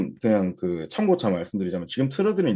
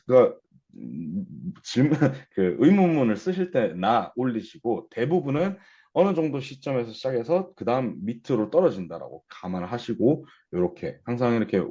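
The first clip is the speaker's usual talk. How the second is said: ordinary talk, nothing marked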